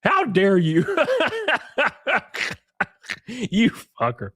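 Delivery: laughing, high shocked oh; mock outrage. Streamer pretending outrage at a chatter through laughs. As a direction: fake offense through laughter.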